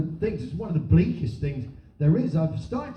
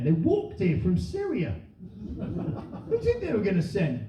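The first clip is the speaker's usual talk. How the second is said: usual speaking voice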